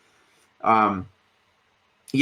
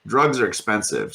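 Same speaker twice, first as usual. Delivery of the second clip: usual speaking voice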